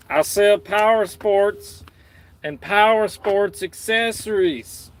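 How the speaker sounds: put-on Southern accent. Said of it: "work on my Southern accent".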